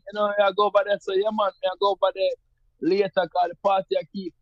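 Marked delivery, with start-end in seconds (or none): 0.4-1.0 rural Jamaican patois, pitched higher; slangy country Jamaican patois, raised. Shown as a demonstration. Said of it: "a little slang". 1.1-4.3 rural Jamaican patois, pitched higher; easygoing country Jamaican slang. Shown as a demonstration. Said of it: "a little slang".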